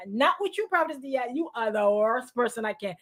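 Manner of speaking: usual speaking voice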